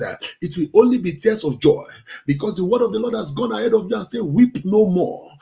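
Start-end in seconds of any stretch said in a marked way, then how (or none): none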